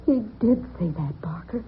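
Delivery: hushed, breathy aside; whisper. Acted. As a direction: whisper it so he won't hear. Frightened aside to Barker.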